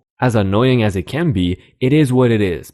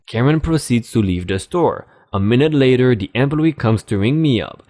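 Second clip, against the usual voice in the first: ordinary delivery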